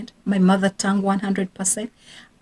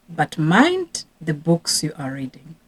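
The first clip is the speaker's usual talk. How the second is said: ordinary talk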